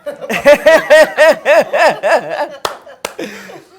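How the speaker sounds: long, loud, high burst of laughter; real laughing fit. Real, not acted. Interviewee cracks up at an aside, loud laughing fit. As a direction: burst out laughing and keep it going.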